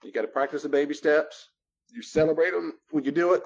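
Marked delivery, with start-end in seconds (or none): none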